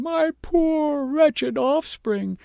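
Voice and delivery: very slow, high wail; lament.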